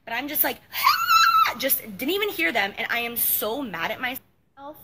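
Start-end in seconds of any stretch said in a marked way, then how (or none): 0.6-1.5 loud, very high burst of noise; oblivious cackle. Retold or imitated. Showing how they missed the warning, a loud high cackle. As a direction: re-enact a shrill, oblivious outburst.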